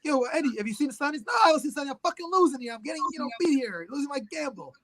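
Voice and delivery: a hint of a losing gambler; gangster dialogue. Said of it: "he's like".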